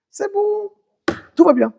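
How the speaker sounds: high, mock reassuring voice; Esav reassuring. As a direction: squeak out a fake all-is-well. (Esav rassure, voix aiguë jouée)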